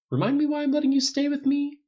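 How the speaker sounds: high-pitched grumbled question; grumbling. A narrator's reading. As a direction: complain in mock annoyance at a friend. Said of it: "Tony grumbled".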